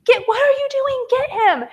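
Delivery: high, strained panicked yell at husband; frantic high-pitched shouting; retold scream. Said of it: "I'm screaming at Cody".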